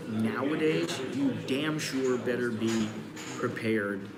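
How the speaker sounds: ordinary talk, nothing marked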